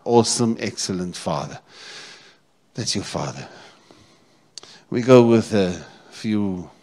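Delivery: usual speaking voice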